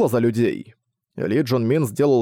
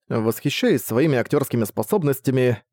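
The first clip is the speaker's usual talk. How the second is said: ordinary delivery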